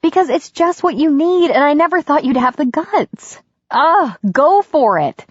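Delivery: high, excited woman's voice; exasperated delight, high and emphatic; Katie, thrilled. A narrator's reading. Katie eggs Connor on.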